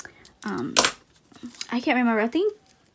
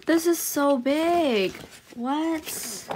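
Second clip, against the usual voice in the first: surprised; 4 semitones higher, much slower than usual. Real, not acted. Huge poster.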